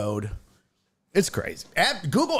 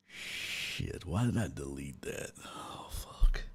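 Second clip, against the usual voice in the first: much softer, half-whispered curse; muttered dismay; 12 semitones lower, 13 dB quieter than usual. Real, not acted. Streamer realizing he deleted his alerts, muttering. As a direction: groan it under your breath.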